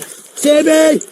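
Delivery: frantic high shout; panicked surrender shout. Real, not acted. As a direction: call out in terror. Man surrendering to police in panic.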